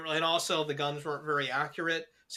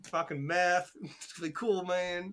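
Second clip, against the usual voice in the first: mock Appalachian accent; 2 semitones higher, slower than usual. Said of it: "They already had the accents".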